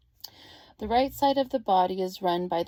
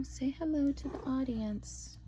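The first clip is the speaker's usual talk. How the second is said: soft whisper coaxing a pet; whispering to the cat; 5 semitones higher, 6 dB quieter than usual. Real, not acted. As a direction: breathe it gently to the cat.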